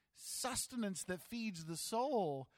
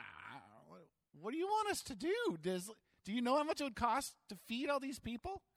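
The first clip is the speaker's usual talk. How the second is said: much higher, disbelieving protest; incredulous; 7 semitones higher than usual. Retold or imitated. Disciples protesting to Jesus about the cost of feeding them. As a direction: squeak out how impossible this is. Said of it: "They're like".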